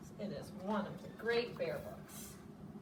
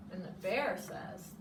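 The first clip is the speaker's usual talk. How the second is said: usual speaking voice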